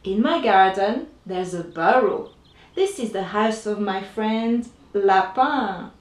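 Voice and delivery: usual speaking voice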